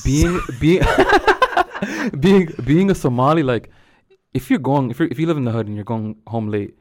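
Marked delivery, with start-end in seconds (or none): 0.0-3.4 high giggling through the words; laughing. Real, not acted. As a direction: laugh while trying to talk. Cracking up mid-sentence.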